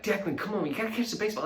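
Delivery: high pleading push; worked-up dad. Retold or imitated. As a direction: urge your son on, a bit too hard. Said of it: "I'm already worked up".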